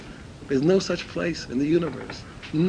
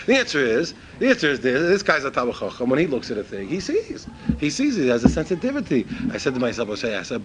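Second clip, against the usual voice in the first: ordinary talk, nothing marked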